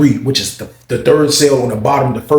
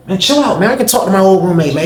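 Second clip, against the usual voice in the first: high brush-off; 6 semitones higher, 3 dB louder than usual. Retold or imitated. Paul brushes bro off.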